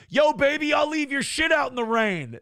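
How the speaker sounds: high, hollered street come-on; catcall. Acted. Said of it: "[name]'s cat call".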